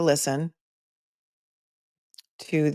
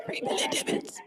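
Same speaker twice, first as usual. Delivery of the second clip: whispering; 10 dB quieter than usual. Real, not acted. Aside whispered under breath.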